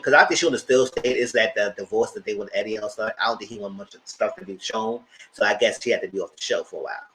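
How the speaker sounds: usual speaking voice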